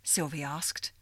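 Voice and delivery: plain reading voice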